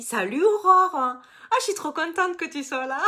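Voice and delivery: high, loud, delighted hello to a friend; bursting with joy at seeing her arrive; excited greeting. Real, not acted. Greeting a friend joining live.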